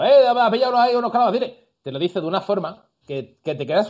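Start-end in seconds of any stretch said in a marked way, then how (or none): 0.0-1.5 high, loud Andalusian call-out; high-pitched Andalusian market patter; Cádiz market accent. Retold or imitated. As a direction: imitate a Cádiz local hollering in thick Andalusian. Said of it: "un pueblo de Cádiz y te viene alguien diciendo".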